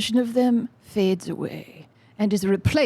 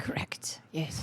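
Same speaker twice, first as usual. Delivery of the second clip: whisper; 5 semitones lower, 15 dB quieter than usual. Acted. Agreeing in a hushed whisper.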